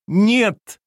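high, loud sharp no; shout. A narrator's reading. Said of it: "опять крикнула она". (громкий крик)

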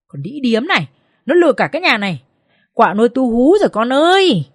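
narrated shrill-tempered mother-in-law outburst; angry screech. A narrator's reading. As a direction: cry out accusingly, swelling towards the end. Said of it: "Rít lên".

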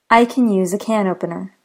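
first "can" weak like "Ken", "can opener" full; reduced can vs full can. Shown as a demonstration. Teacher showing two ways to say can. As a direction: contrast the two cans. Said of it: "I'll repeat this example".

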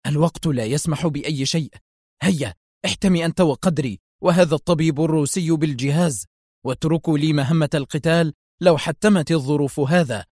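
usual speaking voice

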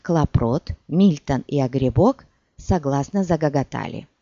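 plain reading voice

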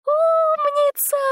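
very high, loud happy cry; happy exclamation. A narrator's reading. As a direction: cry it out with joy. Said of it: "счастливо воскликнул Лютик".

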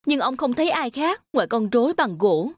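usual speaking voice

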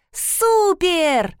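high, drawn-out gleeful cheer; bouncing with delight, pitched well up; excited little one. A narrator's reading. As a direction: squeal it like a child jumping up and down. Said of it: "— запрыгала киса".

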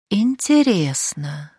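very slow, stretched word; drawn out. Acted. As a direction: drawl it, thinking. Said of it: "протянула Шахразада".

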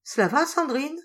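high, bright cheerful check-in. A narrator's reading. The boss asking Sandrine, cheerful. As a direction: lift her spirits with a light question. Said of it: "d'un air gai".

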